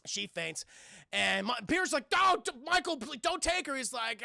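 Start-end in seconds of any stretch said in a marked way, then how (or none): 2.1-3.8 high-pitched mock desperate plea; pleading. Peter begging Morbius to spare his aunt, pleading. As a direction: beg him in a raised voice.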